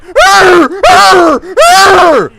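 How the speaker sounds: high, loud mock caveman grunts; shouted mock grunts for a useless player; mocking Frankie. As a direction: grunt like a clueless brute. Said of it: "and Frankie's like".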